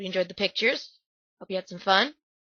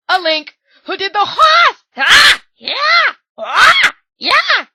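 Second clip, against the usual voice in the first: shouted cheers, far higher and louder; excited shouting; 11 semitones higher, 11 dB louder, much slower than usual. Real, not acted. Fan cheering Link's horse dance loudly. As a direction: yell it like the crowd going wild.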